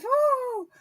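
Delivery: very high-pitched woo; amused whoop. Real, not acted. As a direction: whoop to recover from laughing. Overwhelmed by the awkward singing.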